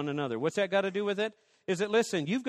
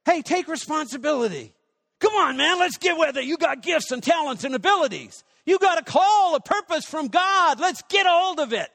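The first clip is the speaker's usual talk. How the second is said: high, loud rallying call; rally them, push them to rise; rousing exhortation; 10 semitones higher, 8 dB louder than usual. Acted. Preacher shouting at the congregation to take responsibility, loud and high.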